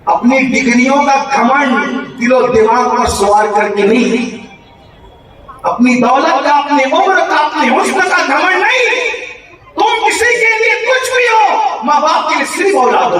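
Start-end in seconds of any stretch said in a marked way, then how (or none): 5.7-13.2 preaching that climbs steadily to a high cry; rousing exhortation. Real, not acted. Preacher admonishing grown children about parents, rousing exhortation. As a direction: build phrase by phrase, pitch rising to a peak. Exhorts crowd, building to climax.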